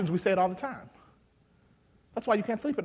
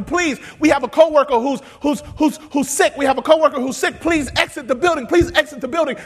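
higher and louder, urging people out; urgent plea; 10 semitones higher, 13 dB louder than usual. Retold or imitated. Store workers pleading with shoppers, urgent and loud. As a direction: raise your voice to clear a store. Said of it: "They were telling people".

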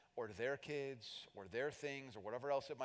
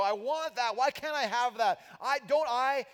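high, loud greedy complaint; envious outburst; 9 semitones higher, 8 dB louder than usual. Retold or imitated. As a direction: demand it like jealous greed. Envious person voiced.

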